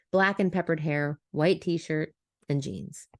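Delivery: plain reading voice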